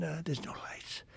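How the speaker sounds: nervous hushed whisper. Retold or imitated. Worried passenger whispering to the captain in the dark, hushed. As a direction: breathe it, barely voiced, uneasy.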